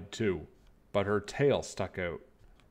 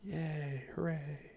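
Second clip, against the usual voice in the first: much softer, high little cheer; 7 semitones higher, 10 dB quieter, slower than usual. Acted. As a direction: echo the cheer gently. Quiet echo of the cheer.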